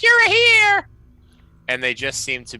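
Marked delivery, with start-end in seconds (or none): 0.0-0.9 piercingly high, very loud bird screech; crow voice. Acted. Crows greet the newly arrived birds, high loud screech. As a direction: squawk it like a crow greeting another. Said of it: "the other ones are like".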